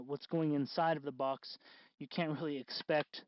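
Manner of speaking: ordinary talk, nothing marked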